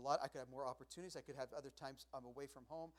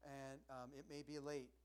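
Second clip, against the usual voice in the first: ordinary talk, nothing marked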